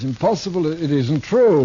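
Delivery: loud, very slow stage denial; theatrical protest. Acted. Actor accused of murder protests to the marshal, loud and drawn-out. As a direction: fling the denial out like a tragedian.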